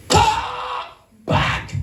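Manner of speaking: high ringing command; commanding call. Retold or imitated. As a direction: call the spirit back with force. Pastor calling spirit back.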